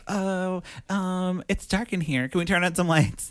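high, hesitant woman's plea; nervous high voice. Retold or imitated. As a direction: ask for the lights with a nervous quaver. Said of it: "she's like".

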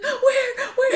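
high, loud frightened cry; panicked child. Retold or imitated. Mother imitates her daughter panicking about a bee, high and loud. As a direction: shriek it like a scared little girl. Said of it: "And she's like".

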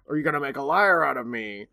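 lightly voiced carnival barker; barker's showman call. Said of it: "and the Barker yells".

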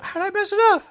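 very high, giggly tipsy whine; tipsy and baffled, squeaky. Real, not acted. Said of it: "I'm a little tipsy off that Polish vodka".

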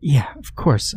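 whispered, shaky breath; shuddering breath. Acted. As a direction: breathe it out shakily. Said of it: "through a shuddered breath".

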